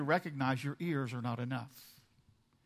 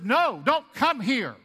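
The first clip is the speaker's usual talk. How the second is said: slow, loud, stern "No, don't come here"; 7 semitones higher, 8 dB louder, slower than usual. Shown as a demonstration. Preacher showing God's no, said stern and loud. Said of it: "that probably sounded mad".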